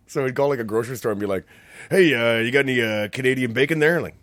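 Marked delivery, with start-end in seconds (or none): 1.9-4.0 lightly acted, loud phone prankster; mock prank caller. Imitating a bad prank call to a grocery store, loud. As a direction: put on a hint of a clumsy prank caller.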